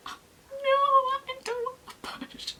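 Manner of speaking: very high, wailing dread; whining refusal. Retold or imitated. Patient told she is ten centimetres, whining refusal. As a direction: start in a high wail, fade to a squeak.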